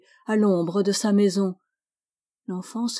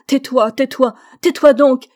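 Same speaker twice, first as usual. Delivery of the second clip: loud, higher, harsh shouting; old man yelling in anguish, getting louder; 4 semitones higher, 10 dB louder than usual. A narrator's reading. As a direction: bark at the child to stop. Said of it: "Il crie plus durement encore".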